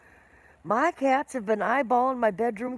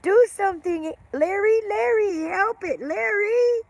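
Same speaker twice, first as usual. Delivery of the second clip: high, loud, frantic wife begging for help; imitating wife yelling; 10 semitones higher, 7 dB louder than usual. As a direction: cry out his name like a panicked wife, pitched way up. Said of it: "she started yelling at him".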